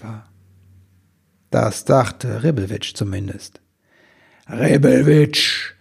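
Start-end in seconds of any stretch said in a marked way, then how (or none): 4.5-5.7 booming drawn-out call of the name; gewaltige Stimme. A narrator's reading. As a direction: thunder it slowly in a mighty voice. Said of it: "tönte da eine gewaltige Stimme". (gewaltig dröhnend, langgezogen)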